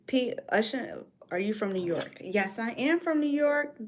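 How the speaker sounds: normal talking voice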